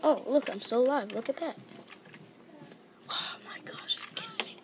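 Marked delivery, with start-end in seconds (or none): 3.1-4.6 frustrated whisper; whispered disbelief at the game. Real, not acted. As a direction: hiss it under your breath so nobody hears. Whispering in the library.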